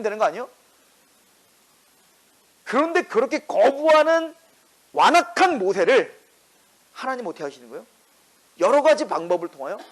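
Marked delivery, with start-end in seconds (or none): none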